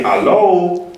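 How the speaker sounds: Hello pitched high and bright, rising up; rising call hello. Shown as a demonstration. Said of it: "and then, uh, the people say".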